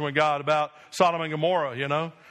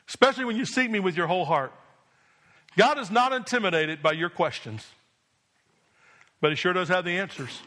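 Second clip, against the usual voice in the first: usual speaking voice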